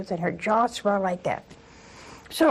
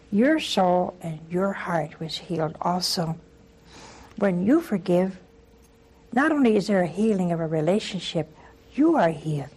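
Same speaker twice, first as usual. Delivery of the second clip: ordinary talk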